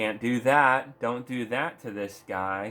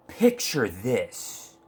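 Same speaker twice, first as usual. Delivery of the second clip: mock dramatic reading; 4 semitones higher, 3 dB louder, slower than usual. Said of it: "This is what they said".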